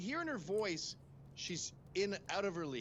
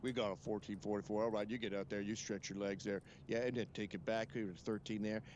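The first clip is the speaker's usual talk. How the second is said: an unbothered Southern US pilot on the radio, drawled and low; deep Southern pilot; 8 semitones lower than usual. Retold or imitated. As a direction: lay on a relaxed Southern US pilot voice, pitched low. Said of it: "I wanna hear deep voices, maybe a slight south of the Mason-Dixon line".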